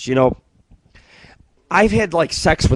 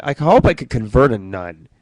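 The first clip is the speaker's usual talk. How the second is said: ordinary talk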